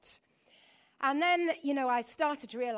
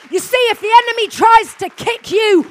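high, very loud rallying cry; shouted preaching; 8 semitones higher, 22 dB louder than usual. Real, not acted. Preacher rouses congregation against the enemy, shouted preaching. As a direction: preach it at the top of your voice.

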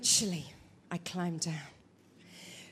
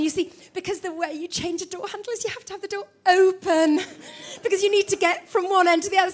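ordinary talk

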